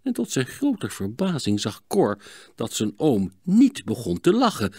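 ordinary delivery, nothing marked

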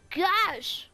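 sudden loud gasp of surprise, pitch jumps up; surprised exclamation. Real, not acted. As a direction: blurt it out, caught off guard. Sudden surprise while gaming.

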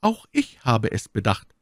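usual speaking voice

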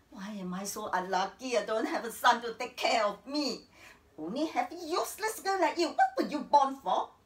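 bitter complaint rising to loud scolding; scolding mother. Acted. Mother berating her useless daughter as she leaves, angrily.

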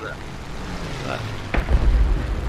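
drawn-out exasperated wail. Real, not acted. Gunner abandoned, exasperated wail.

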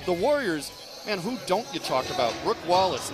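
ordinary talk, nothing marked

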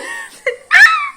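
very high, loud squeal of laughter; high laughing squeal; shrieking laugh. Real, not acted. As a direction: burst into a shrill disbelieving laugh. Shrieking laugh after quote.

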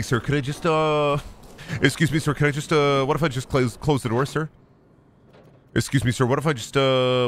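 usual speaking voice

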